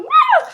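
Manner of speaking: high, loud mock child's scream; a little boy screaming at a dark figure in his room. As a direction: let out a sudden kid's scream, way up high. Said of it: "And then he screams".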